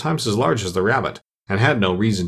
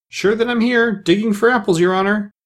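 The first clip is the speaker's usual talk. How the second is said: much higher-pitched servant; servant's voice; 10 semitones higher, 3 dB louder than usual. A narrator's reading. Pat answering the Rabbit, high servant's voice. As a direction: answer the master in a high, eager voice. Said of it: "a voice she had never heard before".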